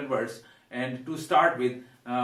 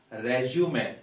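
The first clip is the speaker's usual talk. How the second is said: noun 'resume' as 'REZ-joo-may'; first-syllable stress. Shown as a demonstration. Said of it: "we stress the first syllable".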